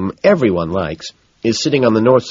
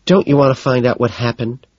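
higher, put-out question; displeased; 6 semitones higher, 3 dB louder than usual. A narrator's reading. Evelyn to her inattentive date, displeased. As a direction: ask it slightly offended.

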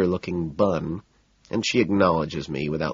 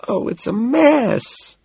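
high, drawn-out dramatic wail; 4 dB louder, much slower than usual. A narrator's reading. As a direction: wail it in mock despair. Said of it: "she wails".

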